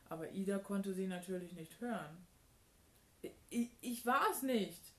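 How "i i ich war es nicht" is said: high, louder stuttered denial; stammering. A narrator's reading. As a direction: stutter on the first word, then protest loudly. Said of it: "stotterte Benny". (stotternd abgestritten)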